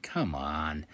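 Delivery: whispered, fed-up groan of words; whispered disbelief at the critics; exasperated whisper. Real, not acted. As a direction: hush it out in disbelief. Exasperated aside.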